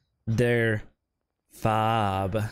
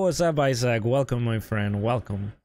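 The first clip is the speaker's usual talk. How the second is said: ordinary talk, nothing marked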